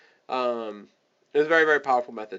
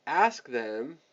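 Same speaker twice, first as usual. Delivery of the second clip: ordinary talk, nothing marked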